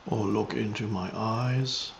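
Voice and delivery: meditative teaching, measured and spacious; contemplative spiritual guidance. Real, not acted. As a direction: guide listeners into presence, unhurried.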